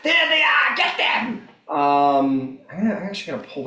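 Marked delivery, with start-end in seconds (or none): 0.0-1.5 high, urgent monster war cry; raised, high-pitched battle yell; shouting in Draconic. Acted. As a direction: shout the alarm to your fellow warriors. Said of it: "you hear it shouting Draconic".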